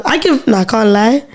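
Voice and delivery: usual speaking voice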